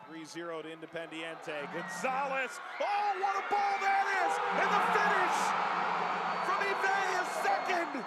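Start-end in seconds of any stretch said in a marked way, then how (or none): 1.7-8.0 screaming much higher and louder; goal call shout. Real, not acted. Goal call in live commentary.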